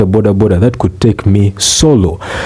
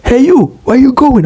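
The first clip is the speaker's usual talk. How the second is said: high-pitched calling voice; touting conductor. Retold or imitated. Taxi conductor asking for more passengers, calling out. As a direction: call out to passers-by for fares. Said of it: "conductor is actually asking for more passengers".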